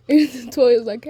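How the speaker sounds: ordinary delivery, nothing marked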